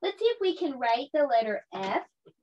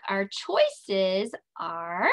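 slow, stretched build-up; drawn-out suspense; 3 semitones lower, much slower than usual. Shown as a demonstration. Teacher to kids, slow suspenseful lead-in. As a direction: draw it out for suspense. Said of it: "here it comes".

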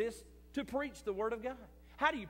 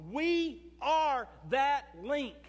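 loud, very slow, each word hammered; slow emphatic preaching; 7 semitones higher, 8 dB louder, much slower than usual. Acted. Pastor telling his church they are the link, loud and very slow. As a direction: plant every word like a nail. Sermon climax.

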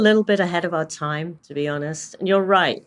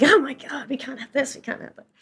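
exasperated; 10 semitones higher than usual. Retold or imitated. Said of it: "Bill and I would be like".

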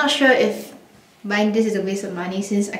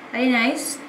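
ordinary talk, nothing marked

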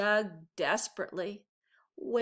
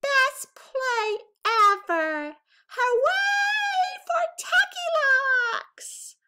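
much higher, louder, slow cheer; 8 dB louder, slower than usual. A narrator's reading. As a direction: cheer for Tacky like an excited class. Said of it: "they cheered".

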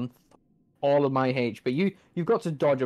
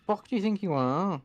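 ordinary delivery

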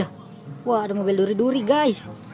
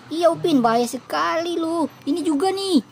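put-on a higher, excited kid voice warning Upin; the worried twin, pitched up; lightly voiced little twin; 9 semitones higher than usual.

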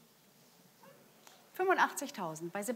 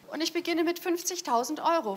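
auctioneer; 9 semitones higher, 6 dB louder than usual. Real, not acted. Opening bid at art auction.

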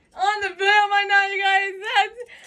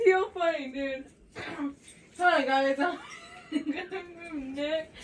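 ordinary talk

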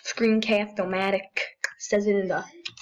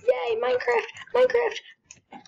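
excited cheer; 14 semitones higher than usual. Real, not acted.

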